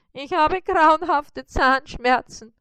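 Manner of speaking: high moaning spider in toothache; moaning in pain. Acted. Spider moans to Bissi about his tooth, high and pained.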